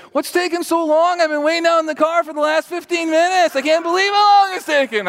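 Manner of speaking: high, loud, impatient dad complaining; dad storming in from the car, impatient and loud. Retold or imitated. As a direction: blast it like a fed-up father waiting in the car. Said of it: "and he says".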